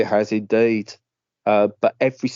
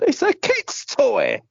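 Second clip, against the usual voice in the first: high, slow mock Elijah Wood voice; hammed-up high-pitched slow impression; 14 semitones higher, slower than usual. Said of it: "gone the full Elijah Wood and gone".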